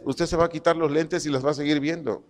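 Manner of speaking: ordinary delivery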